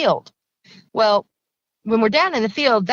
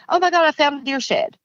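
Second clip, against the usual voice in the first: higher-pitched, thrilled husband's outburst; excited exclamation; 6 semitones higher than usual. Retold or imitated. Husband announces his find to the family, excited. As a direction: imitate an excited discovery, pitched up.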